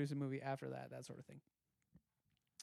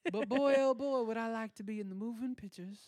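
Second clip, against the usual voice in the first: put-on Southern accent; 3 semitones higher than usual. Acting simple Southern boy.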